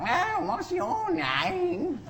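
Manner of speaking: very high, slow, drawn-out mock cat voice; snooty cat voice. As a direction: play a sniffy cat sizing up the newcomer. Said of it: "all the other cats going".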